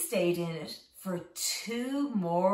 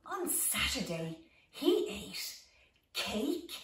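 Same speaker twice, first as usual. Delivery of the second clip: ordinary delivery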